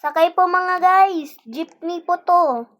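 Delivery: high, loud driver's call; jeepney barker. Acted. Calling passengers as jeepney driver.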